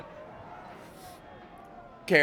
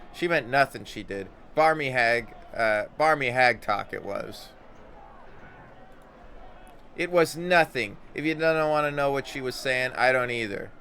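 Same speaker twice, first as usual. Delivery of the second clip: louder Scots-tinged brush-off; dismissive Anna; 3 semitones higher, 5 dB louder than usual. Acted. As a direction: wave it away, annoyed.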